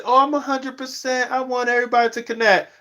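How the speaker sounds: high, sing-song mock of fake YouTubers; mocking fake sincerity. Vlogger mocking people who claim pure motives, high sing-song. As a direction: lay on the fake earnestness thick. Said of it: "they say".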